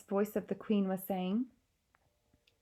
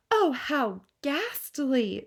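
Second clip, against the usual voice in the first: horrified cry; 6 semitones higher, 6 dB louder, much slower than usual. Acted. Queen reacting to the article, horrified. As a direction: gasp then a slow, louder higher cry. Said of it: "the famous voice cried out".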